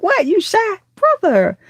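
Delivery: high-pitched mock astonishment; playful disbelief. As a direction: tease with a high, incredulous question. Retells her disbelief at his shyness.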